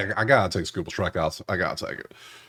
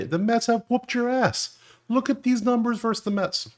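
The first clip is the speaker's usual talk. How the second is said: exasperated outcry. Real, not acted. Sports bettor appalled by Gray's numbers versus the Mets, exasperated outcry.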